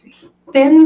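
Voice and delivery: ordinary talk, nothing marked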